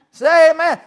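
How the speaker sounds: high and loud, laughing; loud call for amen. Real, not acted. Preacher to the congregation, loud laughing call. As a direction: rouse the room. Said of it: "asks congregation".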